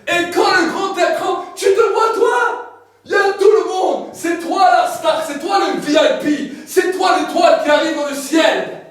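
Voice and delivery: loud, high booming proclamation; preaching at full force. Acted. Preacher describing heaven's throne to the congregation, loud. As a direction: shout the glory of heaven to the crowd.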